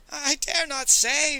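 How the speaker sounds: high, loud, drawn-out frightened wail. A narrator's reading. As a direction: wail it slowly in terror. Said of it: "Fang wailed".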